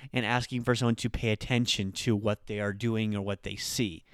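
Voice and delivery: ordinary talk, nothing marked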